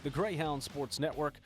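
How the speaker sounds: upbeat host read over intro music; bright sports podcast opener. Acted. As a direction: announce the show like a radio host.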